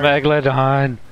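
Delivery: loud, high shout of the name; alarmed shout. Real, not acted. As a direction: yell out the giant shark. Shark attack, alarm.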